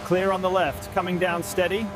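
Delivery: crew chatter over headset radio; helmet radio. Real, not acted. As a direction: sound like a radio transmission.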